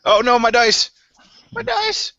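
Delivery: high-pitched real dismay; dismayed cry. Real, not acted. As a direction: cry out as something slips away from you. Player dismayed about own dice.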